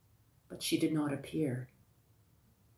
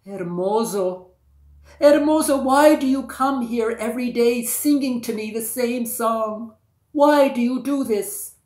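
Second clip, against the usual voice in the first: calling down loudly, much higher and louder; crying out; 8 semitones higher, 11 dB louder than usual. Acted. Princess calling down to the prince in his boat, loud cry. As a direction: call out from the balcony in high, loud wonder. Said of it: "she cried out to him".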